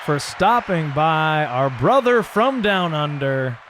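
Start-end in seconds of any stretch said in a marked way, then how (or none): 1.4-3.6 drawn out, a little slower; slower repeat. Real, not acted. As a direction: stretch the nickname fondly. Repeats the guest's nickname, slower.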